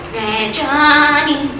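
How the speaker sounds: slow, low, drawn-out Red Johnny; dad's catchphrase. Retold or imitated. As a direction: croon it like a goofy dad. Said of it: "he'll go".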